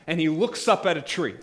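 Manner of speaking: ordinary talk, nothing marked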